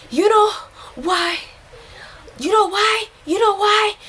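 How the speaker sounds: high, breathless "you know why?"; mock someone worked up and gasping between words; high-pitched agitated imitation; imitating hyperventilating outburst. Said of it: "breathing so fast, you know".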